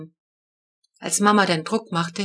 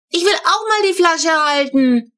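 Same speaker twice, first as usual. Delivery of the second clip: put-on high, loud demanding child's yell; child yelling to hold the bottle; shouting; 9 semitones higher, 6 dB louder than usual. A narrator's reading. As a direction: bellow the demand. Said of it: "brüllte Flo so laut".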